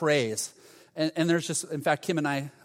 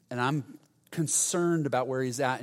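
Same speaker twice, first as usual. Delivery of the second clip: ordinary delivery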